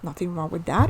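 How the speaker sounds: ordinary talk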